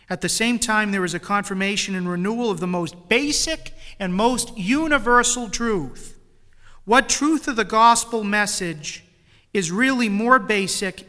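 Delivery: loud, forceful sermon delivery; fervent preaching. Acted. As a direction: preach it to the whole church.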